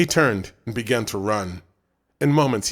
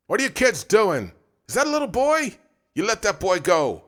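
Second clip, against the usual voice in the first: yelling man; 11 semitones higher than usual. Acted. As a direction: shout across at the boys to stop. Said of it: "still yelling".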